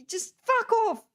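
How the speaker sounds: high, exasperated dismissal; exasperated outburst. Real, not acted. Dismissing jargon they find stupid, exasperated outburst. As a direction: voice leaps up, fed up.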